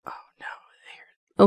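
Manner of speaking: hushed scared whisper. Real, not acted. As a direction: whisper it so nothing hears you. Scared in a horror game.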